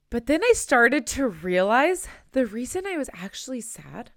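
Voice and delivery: ordinary talk, nothing marked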